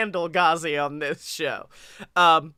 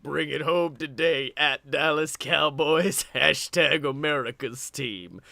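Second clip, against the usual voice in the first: low, soft Deadman rumble; hushed deep Undertaker tweet read; 5 semitones lower, 4 dB quieter than usual. Retold or imitated. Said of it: "you gotta say that in his voice".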